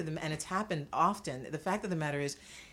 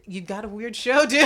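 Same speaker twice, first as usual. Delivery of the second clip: cheeky retort; 6 semitones higher, 11 dB louder than usual. Retold or imitated. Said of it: "I said".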